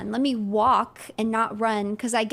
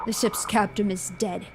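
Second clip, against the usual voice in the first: lightly voiced captain, lower and grave; radio captain; 4 semitones lower, 5 dB quieter than usual. Captain reporting the dead ship's captain, slightly lower and grave.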